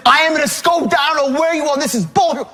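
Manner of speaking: high, ranting mock threat; really angry. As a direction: threaten the caller as if you've snapped. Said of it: "I'm really angry".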